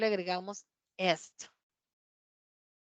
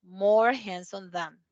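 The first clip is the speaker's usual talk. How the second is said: slow model phrase; much slower than usual. Shown as a demonstration. Teacher modeling English comparative for students, slow and clear. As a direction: say each word slowly and clearly. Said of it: "por ejemplo, handsome".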